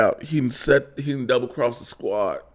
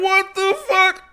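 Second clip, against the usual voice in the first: much higher shocked cry; much higher stunned cry at the carnage; stunned disbelief; 4 dB louder than usual. Retold or imitated. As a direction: react in total disbelief. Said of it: "Harley and Rick Flag are like".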